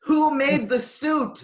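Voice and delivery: high, loud and slow, demanding to know; mock outrage. Podcaster hunting down the suit designer, mock outraged loud demand. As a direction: boom out the question like an outraged fan.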